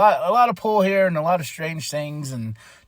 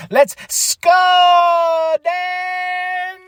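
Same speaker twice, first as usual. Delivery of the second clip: a big high hyped-up shout; hype shout; 7 dB louder, much slower than usual. Retold or imitated. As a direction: yell it like the show's starting. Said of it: "And I was like".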